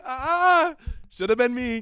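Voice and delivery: high, loud jokey envy; mock jealous. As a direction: fake bitter whine.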